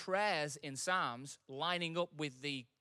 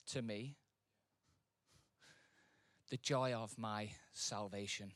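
prayerful psalm reading; 4 semitones lower, slower than usual. Acted. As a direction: breathe the words to God.

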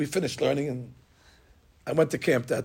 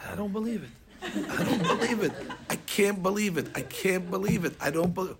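high, stunned laughing repeats; storyteller laughing in disbelief at the coincidence; 4 semitones higher, 3 dB quieter than usual. Real, not acted. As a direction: laugh it off in shock.

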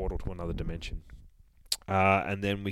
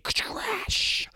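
crash sound effect. Acted. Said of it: "sound effect".

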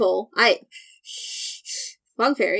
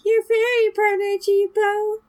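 fairy mascot voice; 10 semitones higher than usual. Acted. Introduces herself as fairy mascot.

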